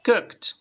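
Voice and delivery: "Cooked" as one syllable ending in T. Shown as a demonstration. Teacher demonstrates the past tense, ending in T. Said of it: "termina en T".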